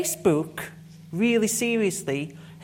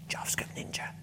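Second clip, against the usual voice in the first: whispered mock hype title; 10 dB quieter, faster than usual. Speaker mocks coder buzzwords for the audience, whispered. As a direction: breathe it like a sarcastic secret. Mocking hyped industry label, whispered.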